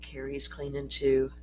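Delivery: usual speaking voice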